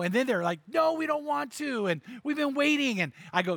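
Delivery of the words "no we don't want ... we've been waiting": put-on high whining kids' voice; whiny kids. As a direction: whine like bored little boys. Sons whining, voiced in story.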